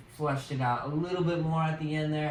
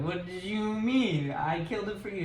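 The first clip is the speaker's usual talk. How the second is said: lightly acted, higher-pitched protest; A-Train; 6 semitones higher than usual. A-Train answers his brother's accusation, higher-pitched protest. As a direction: plead your case, a bit higher. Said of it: "And A-Train's like".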